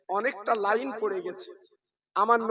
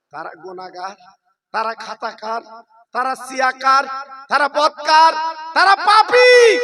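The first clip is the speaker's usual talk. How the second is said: building to a cry; 2 semitones higher, 12 dB louder than usual. Acted. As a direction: build each phrase louder toward the climax. Preacher's list building to a climax. (নিচু স্বর থেকে ধাপে ধাপে চিৎকার)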